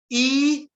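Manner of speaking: I called out high and loud; teacher belts out I to show it is strong; strong vowel. Shown as a demonstration. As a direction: ring out the vowel I. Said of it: "olha o I como é forte".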